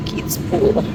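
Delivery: whispered aside. Real, not acted. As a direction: whisper to the camera. Whispering in hotel.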